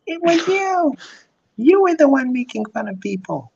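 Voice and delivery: very high, whiny blaming; shrilly pin the blame on others; mock Karen blaming everyone.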